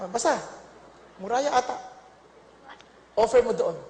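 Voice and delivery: ordinary delivery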